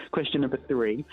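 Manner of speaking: ordinary talk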